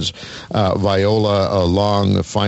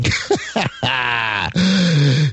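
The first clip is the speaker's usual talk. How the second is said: long burst of real laughter; hearty laugh; 4 semitones higher than usual. Real, not acted. Host laughing at his guest's money joke, hearty laugh.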